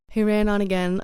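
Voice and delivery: plain reading voice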